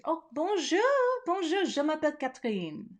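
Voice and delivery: playful French accent greeting. Shown as a demonstration. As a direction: greet them like a Parisian hostess. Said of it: "that's my French".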